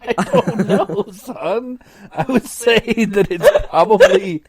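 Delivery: can barely hold back a laugh. Real, not acted. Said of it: "laugh heard".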